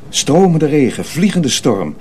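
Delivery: ordinary delivery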